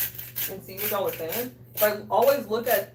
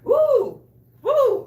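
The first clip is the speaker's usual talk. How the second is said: two high, loud whoops; high-pitched "woo", twice; 12 dB louder than usual. Real, not acted. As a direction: whoop sharply at a strong taste. Reacting to strong taste.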